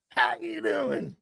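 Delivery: a playful wiseguy greeting in New York Italian; hammed-up New York Italian accent; mock New York Italian. Jokes about being Italian.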